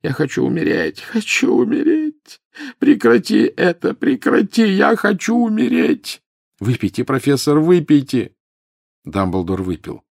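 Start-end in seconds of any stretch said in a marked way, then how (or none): 0.0-6.2 very high, drawn-out wail; anguished screaming lament; tormented howl. A narrator's reading. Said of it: "взвыл с ещё большей, чем прежде, мукой". 6.6-8.3 high, insistent urging; tense raised-voice begging; urgent plea. A narrator's reading. Harry orders howling professor to drink.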